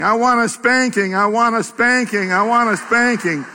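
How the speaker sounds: high, mock child's wail begging; child screaming. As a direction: whine it like a frantic little girl. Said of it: "She even began screaming".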